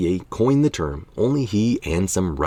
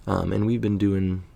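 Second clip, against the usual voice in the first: ordinary talk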